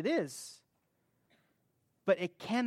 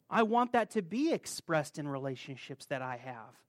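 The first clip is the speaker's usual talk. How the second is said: ordinary delivery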